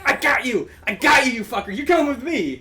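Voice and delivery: high, loud gotcha shout; triumphant yelling. Retold or imitated. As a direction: bellow it as you grab the thief. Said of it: "He's like".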